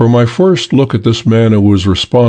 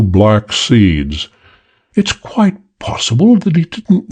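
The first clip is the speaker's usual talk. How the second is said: emotionless British drawl; 2 semitones higher than usual. Acted. The Chinese man lecturing the gang, cool British drawl. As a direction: drawl it calmly, like a bored English gent. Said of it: "the same emotionless drawl".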